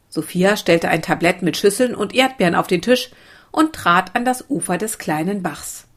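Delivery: usual speaking voice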